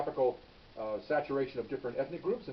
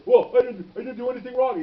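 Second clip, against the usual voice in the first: much higher, much louder protesting denial, imitated; whiny denial; 10 semitones higher, 12 dB louder than usual. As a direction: plead innocence in a squeal. Suspect denies to supervisor.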